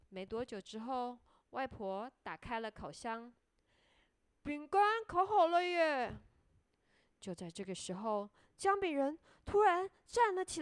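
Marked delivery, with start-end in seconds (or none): 4.4-6.2 high, loud storybook cheer; excited announcement. A narrator's reading. Grandmother opening the oven, excited announcement. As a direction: cheer it out to the children.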